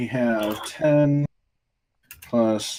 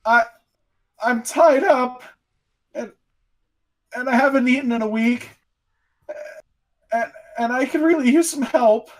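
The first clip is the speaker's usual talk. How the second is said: high, halting plea with long pauses; pleading captive; 12 semitones higher than usual. Acted. Tied-up, starving captive begs rescuers for help, high pleading voice. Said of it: "from the darkness, ... you hear".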